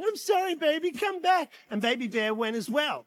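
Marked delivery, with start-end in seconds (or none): 0.0-1.5 high, mock-desperate pleading; hammed-up apologetic cry; pleading husband. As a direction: call pleadingly after a departing wife. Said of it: "Dad ran after her going".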